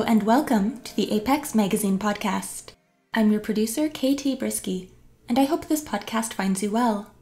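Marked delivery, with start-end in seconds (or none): none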